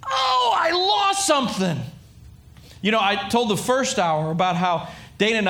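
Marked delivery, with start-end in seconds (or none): 0.1-1.8 hammed-up dismay at a loss; stricken realization. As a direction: gasp as if you just realized what's gone. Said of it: "No, this was a".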